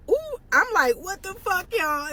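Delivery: high-pitched stunned exclamation; shocked what the fuck. Retold or imitated. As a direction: squeal it out in disbelief. Shock at the confrontation.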